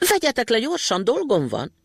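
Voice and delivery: high, urgent shout to be set down; little boy yelling from atop the hat. A narrator's reading. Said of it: "kiabálta".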